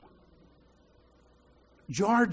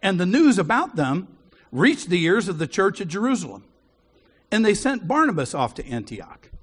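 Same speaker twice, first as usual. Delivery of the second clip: louder, measured Bible reading; scripture reading; 6 dB louder than usual. Acted. Preacher reading Acts to the church, loud and measured. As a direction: proclaim the passage to the congregation.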